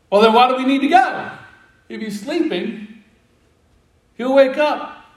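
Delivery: acted-out bewildered follower; puzzled objection. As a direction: toss it back as if it were obvious. Puzzled disciples object to Jesus.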